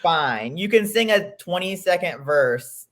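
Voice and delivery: hammed-up exasperation, pressing each point; exasperated retort. Host telling the singer her voice is fine, exasperated. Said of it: "This made me so mad".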